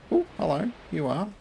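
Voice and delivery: surprised. Real, not acted. Chicken suddenly appears.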